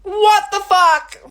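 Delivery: loud, high, drawn-out yell; exasperated outburst. Acted. Woman rants about ex's reversal, loud exasperated yell. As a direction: yell it slowly in disbelief. Outburst after long silence.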